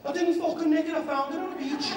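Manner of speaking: high Scouse pirate voice, laughing by the end; mock Scouse pirate. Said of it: "a Scouse pirate".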